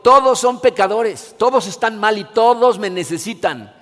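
Jesus proclaiming from the cross. Retold or imitated. Cita de Jesús en la cruz. (Jesús proclamando fuerte y lento)

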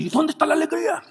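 high, exasperated mock whine; sudden jump up into a whiny complaint; frustrated complaint. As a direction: voice a fed-up believer asking where the joy is. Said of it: "te vas a hartar ... y decir".

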